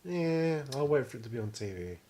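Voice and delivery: usual speaking voice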